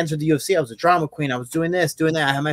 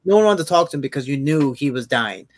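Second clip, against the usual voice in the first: ordinary talk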